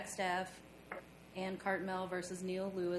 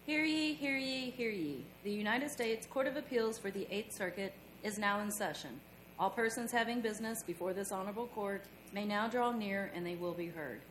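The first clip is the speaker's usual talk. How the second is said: town crier; 10 semitones higher, 8 dB quieter than usual. Real, not acted. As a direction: proclaim it like a court bailiff. Court crier opens session.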